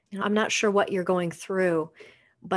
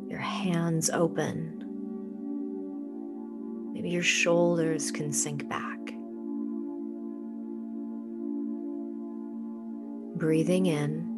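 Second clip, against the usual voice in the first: soft spa whisper; 5 dB quieter than usual. Acted. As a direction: ease them into calm, unhurried. Leads a guided meditation.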